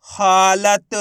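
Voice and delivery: हालत loud and stretched out; drawn-out model of हालत; blended word. Shown as a demonstration. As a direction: read हालत holding each syllable. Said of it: "किस तरीके से इनका उच्चारण करके".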